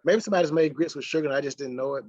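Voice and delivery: ordinary talk, nothing marked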